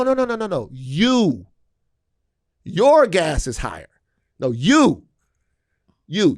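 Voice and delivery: acted, forceful correction with pauses; exasperated insistence. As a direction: punch every YOU hard. Trump hammering message at supporters, acted.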